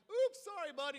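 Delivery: high, loud, sing-song sorry; mock polite apology. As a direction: play the carefree kid brushing it off.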